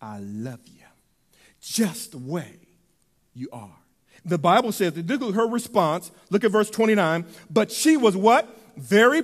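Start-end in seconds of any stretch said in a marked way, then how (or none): 0.0-3.8 hushed, low and tender, much softer; God's tender voice. Acted. God looks at Mary and says he loves her, softly. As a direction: whisper God's love gently like a lullaby.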